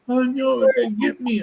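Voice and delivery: high mock-scared little kid voice; a toddler pretending to panic. Two-year-old quoted.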